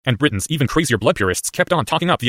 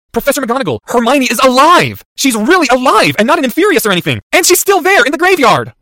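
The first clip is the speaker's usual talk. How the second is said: desperate urging; 14 semitones higher, 8 dB louder than usual. Acted. Boy begging the professor to save Hermione, desperate. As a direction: shout the news, building in urgency.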